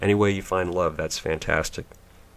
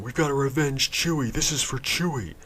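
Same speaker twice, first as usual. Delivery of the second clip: higher, whiny mock grief; mock weepy heroes; 8 semitones higher than usual. Mocking the heroes mourning Chewie, whiny and high. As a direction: whine it like overdramatic heroes. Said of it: "heroes are downtrodden and crying".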